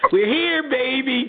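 high, sing-song gloating; chirpy girlfriend. Retold or imitated. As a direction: throw the arrival in his face sweetly. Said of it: "she said".

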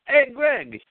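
mock Jimmy. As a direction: pop up and chirp a hello. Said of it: "open up your toilet lid".

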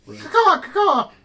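piercing high bird caws; shrill screeching bird woman. Acted. Bird calls during game.

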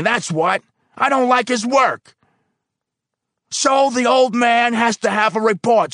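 high, loud phone rant; angry phone call. Acted. Foreman shouting down the phone to personnel. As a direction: bark into the phone.

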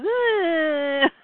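imitated drawn-out strain; acted groan.

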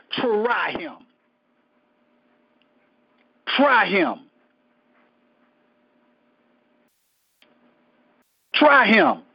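from even to loud proclamation; building challenge. Retold or imitated. God's boast, repeated and building.

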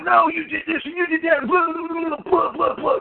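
high-pitched flustered protest breaking into babble; mock yelling. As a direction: imitate an upset witness ranting in blah-blahs. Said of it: "he was yelling and screaming".

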